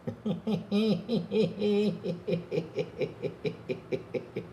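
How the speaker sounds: long breathless laughing fit; helpless laughter. Real, not acted. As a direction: laugh till you can't breathe.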